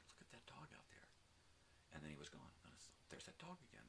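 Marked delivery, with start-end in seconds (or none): 0.1-1.1 hushed whisper, higher and much softer. Retold or imitated. Spotting a stray dog from the hill, whispered. 3.1-3.8 surprised. Retold or imitated. Retelling seeing the dog again.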